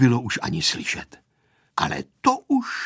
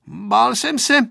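high-pitched boyish admission, lightly voiced; lightly voiced child's grumble; muttering boy; 10 semitones higher, 3 dB louder than usual. A narrator's reading. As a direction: own up to fear, then rally. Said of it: "hučel Pepíček".

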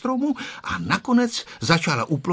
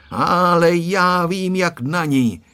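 slow, raised, drawn-out teasing; smiling, knowing; 6 semitones higher, 5 dB louder, slower than usual. A narrator's reading. Grandpa hinting he knows how to handle the goat, smiling. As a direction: stretch it out with a smile in the voice. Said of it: "usmíval se děda".

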